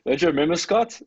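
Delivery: ordinary talk, nothing marked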